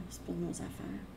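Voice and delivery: C'est pas de nos affaires whispered softly as an echo; soft whispered repeat of the point. Real, not acted. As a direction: let the repeat drop to a whisper. Repeats the phrase, echoed softly.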